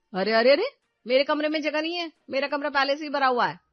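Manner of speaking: loud, alarmed objection; loud refusal to her sister-in-law; loud protest. Acted. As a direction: shut the idea down fast. Sister-in-law refuses furniture in room.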